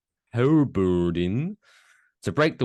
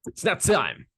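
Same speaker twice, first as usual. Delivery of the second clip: announcer voice; 11 semitones higher than usual. Acted. Segment announcement.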